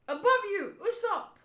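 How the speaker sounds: high, urgent acted cry; warning shout. Teammate warns Usopp of danger overhead, acted warning shout.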